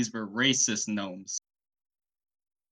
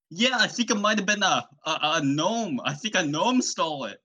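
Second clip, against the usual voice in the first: high-pitched, wavering character voice; hesitant cartoon witness, pitched up; Fisherman Joe; 5 semitones higher than usual. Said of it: "Fisherman Joe, and he was like".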